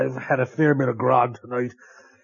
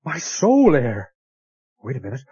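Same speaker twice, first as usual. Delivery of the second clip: ordinary talk, nothing marked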